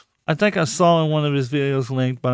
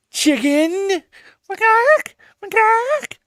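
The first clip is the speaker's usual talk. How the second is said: much higher, squeaky teasing call; mock squeaky taunt; 4 dB louder, slower than usual. Taunting, calling him chicken.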